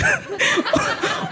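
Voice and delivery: shrieking high laugh; high squealing laugh. Real, not acted. Laughs at own joke.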